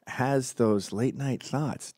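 ordinary talk

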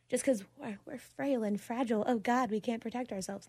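mock-helpless sarcasm; sarcastic. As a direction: feign being frail and fragile, tongue in cheek. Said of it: "That was definitely sarcasm".

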